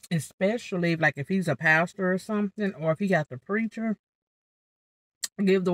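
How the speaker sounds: talking around a fork; fork held in mouth. Real, not acted. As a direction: lips gripping utensil.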